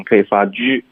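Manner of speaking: ordinary talk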